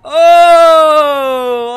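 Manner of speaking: loud startled Oh; sudden loud gasp of shock; shocked shout. Real, not acted. Shocked by a game crash. (grito de susto)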